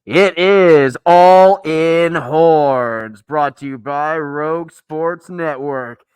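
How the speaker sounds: slow, loud spooky monster intro. Acted. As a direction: boom it out like a monster. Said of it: "I'm gonna take this off".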